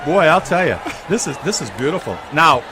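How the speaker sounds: ordinary talk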